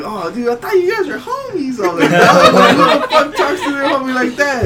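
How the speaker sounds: loud, high, incredulous through laughter; laughing disbelief. Retold or imitated. Cashier answering coworker who thought they were homies, laughing outrage. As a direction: protest in amused outrage, breaking into laughs.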